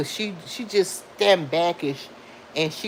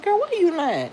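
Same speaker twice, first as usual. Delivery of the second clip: incredulous question; 13 semitones higher, 3 dB louder than usual. Retold or imitated. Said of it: "I said".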